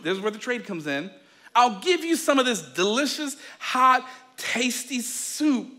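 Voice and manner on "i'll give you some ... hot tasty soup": higher, drawn-out relish; singsong sly sales pitch; tempting tease. Acted. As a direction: draw out each adjective to tempt him. Jacob tempts brother with soup.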